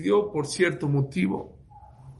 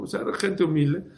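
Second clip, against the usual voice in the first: ordinary delivery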